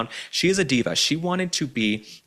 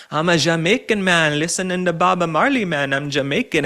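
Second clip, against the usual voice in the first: hammed-up fake Jamaican accent, higher and louder; mock Kamala Harris Jamaican accent; 6 semitones higher, 4 dB louder than usual. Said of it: "trying out some new accents like Kamala Harris".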